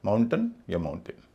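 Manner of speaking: माउंटेन first as "MOUN-tuhn", then "MOUN-tin"; माउंटेन with uh, then short i; correct weak endings. Shown as a demonstration. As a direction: keep the last vowel of माउंटेन short and weak. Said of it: "अ या ई होगा".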